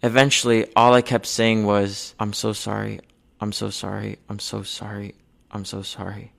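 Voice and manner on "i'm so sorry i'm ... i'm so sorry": repeated apology fading to near silence. Retold or imitated. Reliving begging her knife-wielding mother, fading softer. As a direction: let each sorry get softer until it's almost gone.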